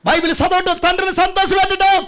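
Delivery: rising, high, loud preaching build; full-force preaching. Acted. Pastor rousing the congregation, building to a shouting preach.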